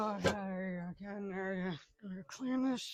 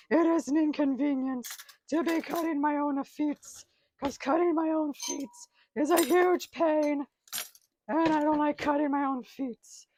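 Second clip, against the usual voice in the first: higher, louder mock-indignant complaining; a touch of whiny rant; 6 semitones higher, 7 dB louder than usual. Man doing dishes rants about cut feet, higher whiny complaint. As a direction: whine about the inconvenience like it's an outrage.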